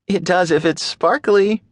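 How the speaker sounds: put-on high playful girl's voice; coyly hint at a gift; playful young woman. A narrator's reading. Maddie voiced, hiding excitement.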